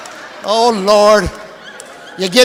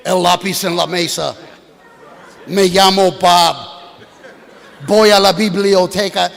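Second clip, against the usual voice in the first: textbook Spanish; 6 semitones lower than usual. Retold or imitated. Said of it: "the kind of Spanish they teach out there is just nonsense".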